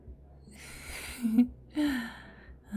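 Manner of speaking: high, amused vampire laugh; knowing laugh. Acted. Vampire catching her captive's hidden thoughts, knowing laugh. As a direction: laugh at a secret you just uncovered.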